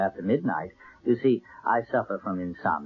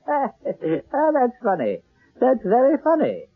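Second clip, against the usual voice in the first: forced laugh; 13 semitones higher, slower than usual. Acted. Accused man laughs off accusation.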